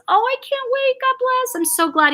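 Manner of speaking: very high, gushing delight; real excitement. Real, not acted. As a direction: gush with joy at the homecoming. Glad a deployed husband returns.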